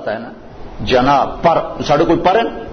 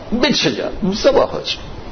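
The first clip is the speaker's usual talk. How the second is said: pleading high; 6 semitones higher, slower than usual. Real, not acted. Impassioned plea in sermon.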